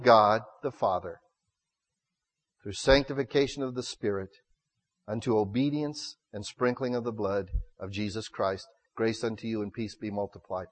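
low, soft, measured reading; hushed scripture reading. Real, not acted. Preacher reading the apostle's greeting to the congregation, low and soft. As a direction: read it out low and quietly.

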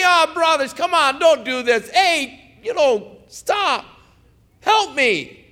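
high, pleading cry to the brothers; weeping plea. Retold or imitated. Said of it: "he was looking back and weeping".

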